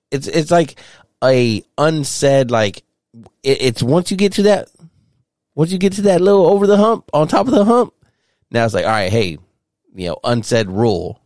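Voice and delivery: high, fast, fired-up rant; worked-up rant. Real, not acted. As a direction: get riled up and vent. Heated rant about unspoken rule.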